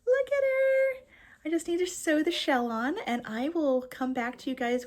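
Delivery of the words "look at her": delighted reveal. Real, not acted. Showing off finished sushi snail.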